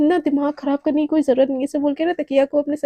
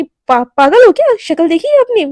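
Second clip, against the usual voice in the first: scornful outburst; 5 semitones higher, 10 dB louder than usual. Acted. Naina scornfully rejects Shivani's question.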